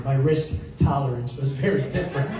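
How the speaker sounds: ordinary delivery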